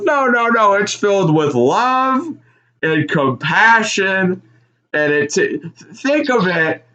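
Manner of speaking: high, very slow, sappy; mock tender [name]. [name] describing a cactus to his partner, high slow sappy voice. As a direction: savor every word like a sermon.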